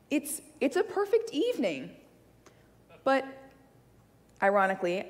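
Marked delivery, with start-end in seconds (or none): none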